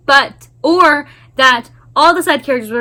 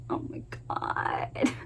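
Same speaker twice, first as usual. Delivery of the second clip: slow whisper; 16 dB quieter, slower than usual. Real, not acted. Overwhelmed by character moment.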